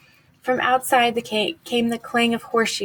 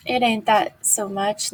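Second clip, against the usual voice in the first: plain reading voice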